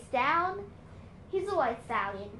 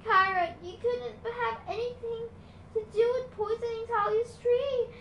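higher, earnest pleading; upset girl; 9 semitones higher, 3 dB louder than usual. A narrator's reading. Annabeth defending Chiron, voice raised and upset. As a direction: defend him, upset and urgent.

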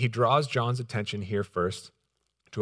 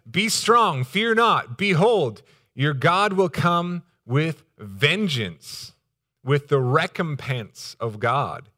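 higher, louder, slowing scripture declaration; forceful proclamation; 5 semitones higher, 5 dB louder, slower than usual. Acted. Preacher reading Isaiah's promise of vengeance, forceful proclamation. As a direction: ring it out like a prophet, slowing on the threat.

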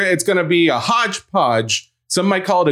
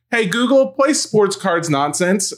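higher-pitched mimicry of a child's command; imitating young daughter; 6 semitones higher, slower than usual. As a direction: call to the smart speaker like a little kid. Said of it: "Charlie's in the other room. She's like".

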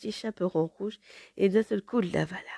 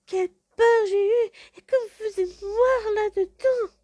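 put-on much higher, slow, shaken little girl; crying out; slower than usual. Girl leaps from the wolf's belly crying out. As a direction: cry out in relief and fright. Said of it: "en s'écriant".

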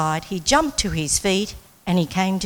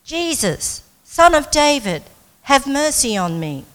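raised, high-pitched call from a reader; shouting plea; 6 semitones higher, 5 dB louder than usual. A narrator's reading. Blind beggar calls to Jesus for mercy, shouting in a reading. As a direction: call out for mercy, higher and louder. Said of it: "he began to shout".

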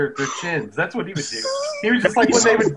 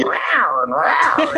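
playful spoken "rawr", the second pitched up; imitated tiger growl; much slower than usual. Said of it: "like, growls and stuff with him".

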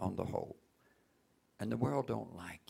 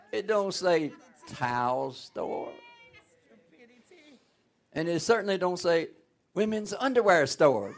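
building preaching; 8 semitones higher, 11 dB louder than usual. Acted. Preaching builds to full force.

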